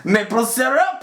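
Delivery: high, exaggerated mock French-Canadian accent. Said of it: "Just the stereotypical".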